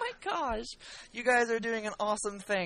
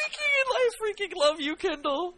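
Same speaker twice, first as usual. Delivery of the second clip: gushing; 11 semitones higher than usual. Real, not acted. Gushing over a listener email.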